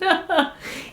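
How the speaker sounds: short, high giggle; amused laugh. Real, not acted. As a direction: laugh lightly at your own silliness. Amused by her pretend phone.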